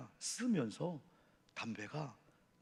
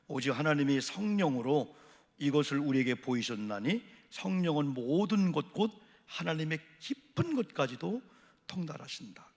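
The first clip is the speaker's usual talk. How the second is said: scripture reading; 2 semitones higher, 7 dB louder than usual. Acted. Preacher reading scripture aloud.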